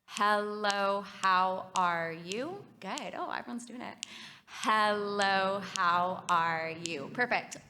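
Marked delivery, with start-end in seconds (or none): 0.0-2.7 slow, syllable by syllable, louder. Shown as a demonstration. Therapist demonstrating rhythmic speech cueing to the group, very slow. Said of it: "which is very slow". 4.5-7.1 slow, syllable by syllable, louder. Shown as a demonstration. Therapist demonstrating rhythmic speech cueing to the group, very slow. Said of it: "which is very slow".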